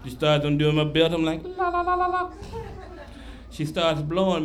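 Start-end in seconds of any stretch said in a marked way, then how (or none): 1.4-2.3 high-pitched "la-la-la" warble; hammed-up falsetto babble of ecstasy; mock pleasure trill. Acts out reaction to belt undone.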